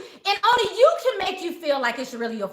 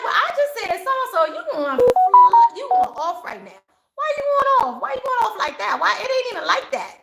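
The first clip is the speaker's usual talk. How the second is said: mock manipulator; 6 semitones higher, 4 dB louder than usual. Manipulator talking over you.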